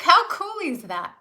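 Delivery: ordinary delivery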